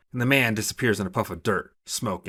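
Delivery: ordinary delivery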